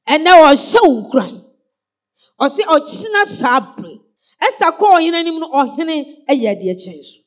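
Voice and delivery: loud, slow, fervent preaching; slow thundering sermon voice; preaching at full force. Acted. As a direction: drive it home to the congregation.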